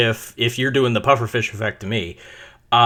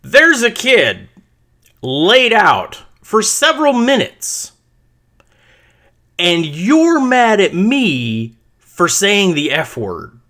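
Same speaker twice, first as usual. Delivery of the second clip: heated retort; 6 semitones higher, slower than usual. Retold or imitated. Parent telling the coach off over the hurt kid, heated. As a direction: push back hard, spelling out the obvious. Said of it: "And I'm like".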